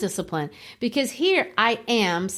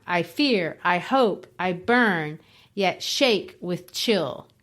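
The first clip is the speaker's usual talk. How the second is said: slow, lower, each stress of "I fear, I hope, I burn" weighed; a slow, lower reading that leans on the stresses; measured recitation; 3 semitones lower, slower than usual. Shown as a demonstration. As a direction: recite the line slowly, beat by beat. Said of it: "I'm sorry, I read it wrong".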